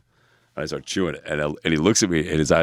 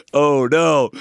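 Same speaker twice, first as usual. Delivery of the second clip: high, loud mock dismay; panicked; 11 semitones higher, 8 dB louder than usual. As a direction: cry out as the trip hits. Flies look like fairies, trip hits.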